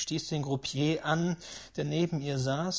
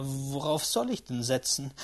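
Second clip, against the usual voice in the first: ordinary delivery, nothing marked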